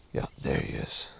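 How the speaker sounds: breathy hushed whisper, unvoiced; wildlife watching whisper. Real, not acted. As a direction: whisper so the deer stay calm. Whispering near deer.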